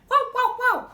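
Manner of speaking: high, quick puppy barks; dog barking. Pretend. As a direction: bark playfully like a small dog. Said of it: "Bark at your friend".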